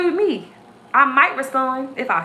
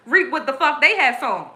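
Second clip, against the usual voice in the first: ordinary talk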